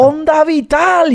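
high, long-held anime attack call; Spain dub attack cry. Retold or imitated. Outdoing a friend's Dragon Ball imitation, high drawn-out cry. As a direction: stretch it into a high mock battle cry. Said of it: "todo en español así".

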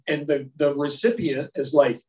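ordinary delivery

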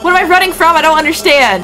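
ordinary talk

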